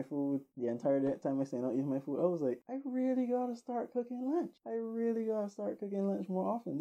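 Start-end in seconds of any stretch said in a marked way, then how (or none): 2.7-6.8 much higher, fed-up self-talk; exasperated vow. Retold or imitated. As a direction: groan it to yourself, half amused. Exasperated self-talk after the incident.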